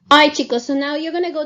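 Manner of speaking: loud call to the class; teacher loudly calls her students to attention. Real, not acted.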